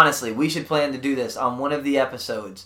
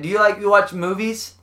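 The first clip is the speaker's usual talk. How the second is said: ordinary talk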